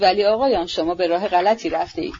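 ordinary delivery, nothing marked

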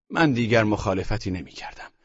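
ordinary talk

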